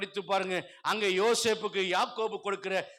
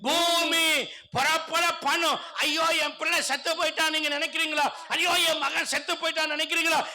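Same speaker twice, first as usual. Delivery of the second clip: high, loud, relentless sermon delivery; full-force preaching; 7 semitones higher, 4 dB louder than usual. Real, not acted. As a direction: preach it to the back row. (முழு வேகப் பிரசங்கம்)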